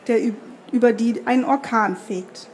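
ordinary delivery

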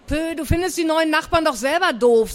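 high-pitched, pouting comeback; defiant retort. Acted. Niece contradicts her aunt about neighbours, defiant retort. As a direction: throw it back at the grown-up.

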